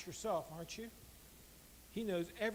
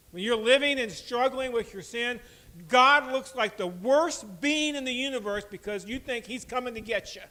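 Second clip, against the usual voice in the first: raised, louder sermon delivery; preaching; 5 semitones higher, 11 dB louder than usual. Acted. Pastor warning listeners struggling with sin, raised preaching. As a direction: press the point on the congregation.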